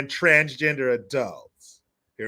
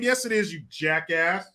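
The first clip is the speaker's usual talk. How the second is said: ordinary talk, nothing marked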